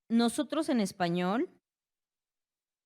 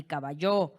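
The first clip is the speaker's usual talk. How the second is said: "caballo" with the final syllable YO hit hard; wrongly stressed last syllable; 4 semitones lower, 3 dB louder than usual. Shown as a demonstration. Teacher ruling out a wrong pronunciation, stress on YO in "caballo". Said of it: "Ni caballo".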